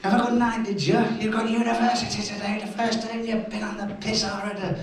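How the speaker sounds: a hint of a scolding mum; mum telling off.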